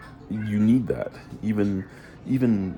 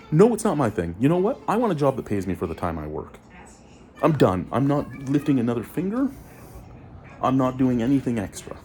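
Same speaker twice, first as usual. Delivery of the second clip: higher, louder, fed-up rant; heated rant; 6 semitones higher, 3 dB louder than usual. Real, not acted. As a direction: declare you are done, loudly. Ranting about quitting.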